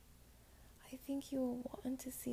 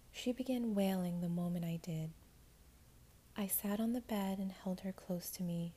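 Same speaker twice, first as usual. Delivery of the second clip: ordinary delivery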